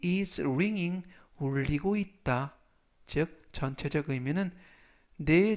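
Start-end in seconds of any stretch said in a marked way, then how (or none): 0.0-1.1 slow model phrase. Shown as a demonstration. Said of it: "꼬리에 아이엔지를 붙여서".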